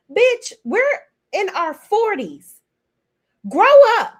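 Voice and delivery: high, loud outburst ending in a yell; exasperated shout. Acted. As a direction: blow up at her to grow up. Snapping back at imitated tantrum.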